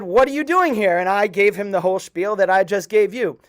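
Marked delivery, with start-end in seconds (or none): 0.0-1.0 higher, louder, delighted to see him; excited surprise. Retold or imitated. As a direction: call out in happy amazement. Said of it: "Well, he said".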